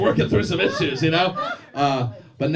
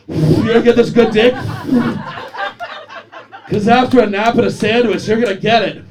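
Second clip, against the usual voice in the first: loud, higher hammed-up come-on through laughter; mock seductive boast; 6 semitones higher, 8 dB louder than usual. Fat guy at her door, booming a hammed-up seductive promise. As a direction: ham up a big guy's pickup line. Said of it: "banging on the door like".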